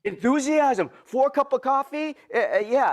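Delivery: hammed-up Japanese accent with dropped grammar; Mister Miyagi. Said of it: "my very bad Mister Miyagi accent".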